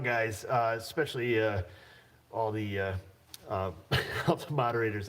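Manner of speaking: ordinary delivery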